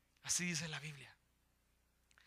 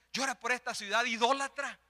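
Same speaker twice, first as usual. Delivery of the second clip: normal talking voice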